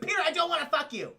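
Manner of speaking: high, fast brush-off; acted exasperated rejection. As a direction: put him off in mock exasperation. Acting Felicia's annoyed rejection.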